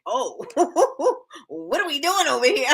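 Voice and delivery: retelling her own words through laughter; half-laughing mock alarm. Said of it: "I was like".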